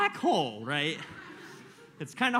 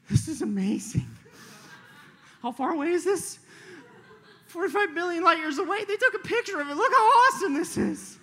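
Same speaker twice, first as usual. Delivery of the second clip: higher, choked-up amazement; tearful awe; 11 semitones higher, 4 dB louder than usual. Retold or imitated. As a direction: marvel as if near tears. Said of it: "I literally started crying".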